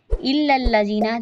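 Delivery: ordinary talk, nothing marked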